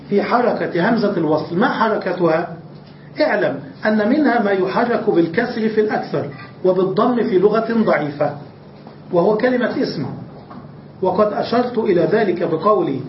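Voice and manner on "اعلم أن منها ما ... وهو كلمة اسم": usual speaking voice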